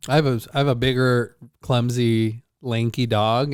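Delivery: ordinary talk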